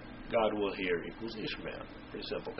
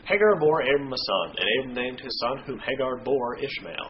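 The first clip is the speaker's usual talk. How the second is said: raised, measured Bible reading voice; scripture reading; 5 semitones higher, 4 dB louder than usual. Acted. Reading Genesis aloud to the listeners, in a formal reading voice. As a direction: read it out like a lesson text.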